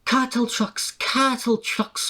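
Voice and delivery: high, slow, sulky coach voice; grumbling coaches. A narrator's reading. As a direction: drag the words out resentfully. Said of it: "grumbled the coaches".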